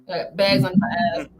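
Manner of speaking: ordinary delivery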